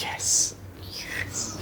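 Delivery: whispered yes. Retold or imitated. Joy at getting the card.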